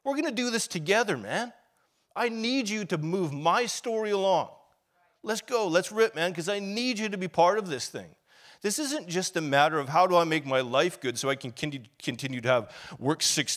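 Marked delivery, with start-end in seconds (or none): 0.0-8.2 higher, louder, urgent calling voice; Jesus rallying him to join the story; a hint of Jesus pumping him up. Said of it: "And he says".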